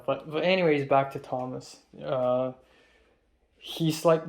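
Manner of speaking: ordinary delivery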